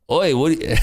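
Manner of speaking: badly faked Canadian greeting; botched Canadian accent. Streamer joking about Canadians up there, botched Canadian accent. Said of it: "no Canadian says, "Oi".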